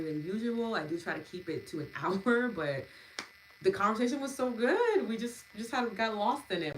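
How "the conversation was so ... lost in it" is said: excited. Real, not acted. Delighted about the long session.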